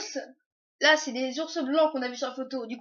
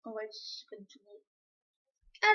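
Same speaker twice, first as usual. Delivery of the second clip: nature documentary voice; 4 semitones lower, 10 dB quieter, much slower than usual. Pretend. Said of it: "Avec la voix de documentaire animalier".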